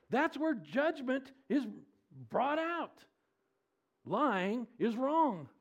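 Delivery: high, slow, emphatic proclamation; full-force preaching. Real, not acted. Preacher declares judgment to the congregation, full-force preaching. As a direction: hammer out each phrase from the pulpit.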